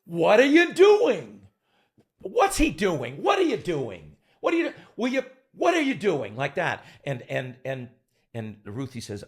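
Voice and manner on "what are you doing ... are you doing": high, loud, slow demand; exasperated director. Retold or imitated. Director berating the actors, high and loud. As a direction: keep hammering the question. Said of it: "Hal goes".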